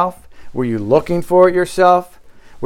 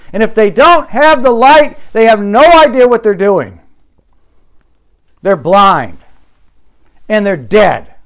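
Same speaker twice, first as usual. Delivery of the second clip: louder, higher, driving pulpit delivery; fiery preaching; 6 semitones higher, 6 dB louder than usual. Acted. Pastor drives home his point to the church, fiery preaching. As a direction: preach it hard to the congregation.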